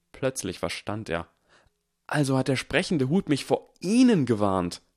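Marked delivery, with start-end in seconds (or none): 2.1-4.8 higher and louder, accusing; sudden realisation. A narrator's reading. Harry accuses Snape, sudden realisation. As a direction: it clicks, and you throw it back.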